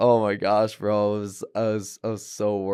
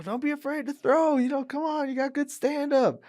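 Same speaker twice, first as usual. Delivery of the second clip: much higher pep talk; coach encouragement; 14 semitones higher than usual. Retold or imitated. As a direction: pump up your fighter from the corner. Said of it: "they go, they go".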